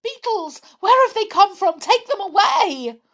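very high, loud panicked shrieks; terrified yell. Acted. Amelia sees the plasticine beetles and panics, shrieking. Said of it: "she gave such a yell".

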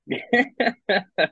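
high-pitched giggle; high burst of laughter. Real, not acted. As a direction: crack up at your own joke. Laughs at own kangaroo joke.

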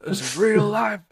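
over-the-top coach barking at a crying peewee; hammed-up drill coach. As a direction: ham up a drill sergeant scaring six-year-olds.

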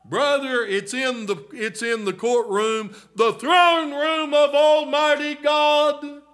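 loud, high, rising preacher's cry to the flock; full-force preaching. Acted. Preacher calling to the congregation about hope, full-force preaching. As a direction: preach it out, pleading and building.

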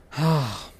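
high, loud exhaled sigh; real heavy sigh. Real, not acted. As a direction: let out a pained sigh. Stomach pain, pauses her story.

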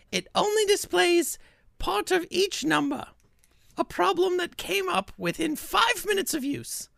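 high, piercing reviewer voice; high-pitched put-on voice of an upset customer; Ginny's voice. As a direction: read her review in a high, whiny voice. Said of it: "This is what Ginny sounds like to me".

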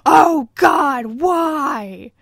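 high, loud anguished cry; mock anguished wail. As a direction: wail it like a dying victim. Lying wounded on floor, groaning.

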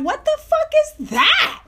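high, stretched-out disbelief; shocked shriek. Retold or imitated. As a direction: react to something gross. Shocked at seeing his dick.

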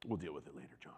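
low, soft menacing aside; a quiet threat muttered under the breath; mock veiled threat. Preacher acts out threatening John, low mock menace. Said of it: "This is an example".

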